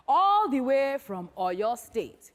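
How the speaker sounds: slow, drawn-out name call; game show announcement. Acted. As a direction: stretch the family name out. Host announces family.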